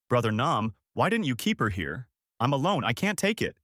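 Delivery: plain reading voice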